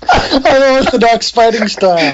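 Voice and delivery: amused repeat through laughter; laughing. Real, not acted. Co-host echoing the old magazine's wording, laughing. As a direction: laugh as you echo the phrase.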